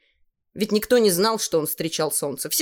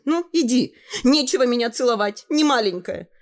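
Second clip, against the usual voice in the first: put-on girl's voice; 7 semitones higher, 3 dB louder than usual. Girl refusing kiss.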